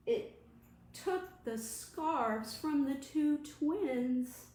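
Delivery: put-on lively picture-book read-aloud to children; story reading. Librarian reading The Wind Blew to children, lively. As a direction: paint each gust for the little ones.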